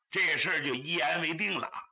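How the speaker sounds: usual speaking voice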